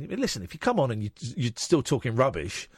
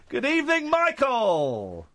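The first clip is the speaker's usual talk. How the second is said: very high, drawn-out hello, gliding down; comic greeting; 12 semitones higher, 3 dB louder, slower than usual. Pretend. Radio host welcoming caller Michael, comically high and drawn out. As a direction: hold the name high, then let it fall.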